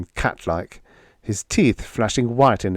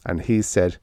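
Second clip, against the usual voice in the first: ordinary delivery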